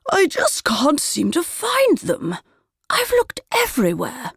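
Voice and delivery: put-on high, slow, fretful old lady voice; a dithery woman at the gate, at her wits' end; flustered lady. A narrator's reading. Prudence asking Willow for help, high and flustered. Customer fretting over lost glasses.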